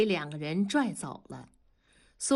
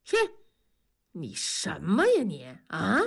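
lower-pitched indignant retort with a sharp swoop; indignant scolding; 3 semitones lower than usual. A narrator's reading. Worker cursing his friend's suspicion, indignant. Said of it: "同志张口大骂".

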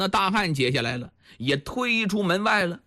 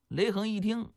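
ordinary delivery

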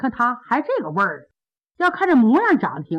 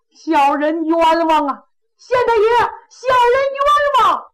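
much higher and louder, wailing the plea; big fellow bellows his grievance to the court; ringing cry of injustice; 8 semitones higher, 3 dB louder than usual. Acted. Plaintiff cries to magistrate for justice, ringing loud. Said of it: "这大个子嗓门儿挺亮".

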